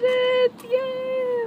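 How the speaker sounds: high squeal of joy; excited squeal. Real, not acted. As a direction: squeal with delight. Just got the camera.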